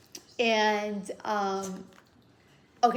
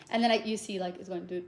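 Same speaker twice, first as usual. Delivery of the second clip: usual speaking voice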